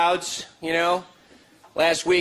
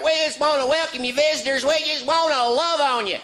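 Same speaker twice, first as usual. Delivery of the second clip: high, syrupy welcoming pastor voice; mock gushing preacher; sugary pastor; 10 semitones higher than usual. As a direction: greet the visitors a bit too sweetly. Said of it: "the pastor's like".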